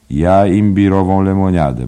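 a high, loud, odd-sounding order of ginger ale; Bantam Lyons ordering. Acted. Bantam Lyons ordering at the bar, high and loud. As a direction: call out the drink cheerfully. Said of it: "powiedział Bantam Lyons".

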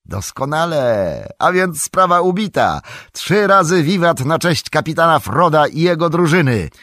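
high, loud joyful shouting; triumphant hobbits cheering Frodo; excited cheer. A narrator's reading. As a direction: cheer the captain like a crowd. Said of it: "krzyknęli wszyscy".

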